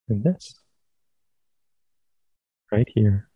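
calm, unhurried phrases with long pauses; guided meditation. Real, not acted. Teacher leading listeners into sitting meditation, calm guided meditation. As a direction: guide them gently into stillness, leaving space between phrases.

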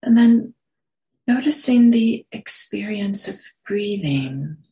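unhurried meditation guidance with long pauses; guided meditation. Real, not acted. Meditation teacher settling listeners into posture and breath, guided meditation. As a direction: ease them gently into stillness, pausing between phrases.

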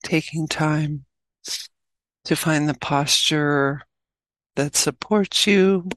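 measured meditation guide with long pauses; unhurried instructions, leaving long silences; guided meditation. Acted. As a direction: guide them gently into stillness, phrase by phrase.

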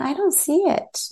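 high-pitched mock protest; lightly acted student failing to spot it; puzzled follower. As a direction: pitch it up, playing someone who can't see. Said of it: "some may be just like".

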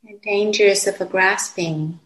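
calm, unhurried meditation voice with long pauses; guided meditation. Real, not acted. Teacher guiding meditators to settle into the body, calm and unhurried. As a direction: guide them gently, phrase by phrase, leaving long silences.

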